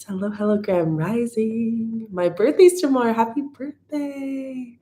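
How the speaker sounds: beam up the birthday wish; excited high greeting. Real, not acted.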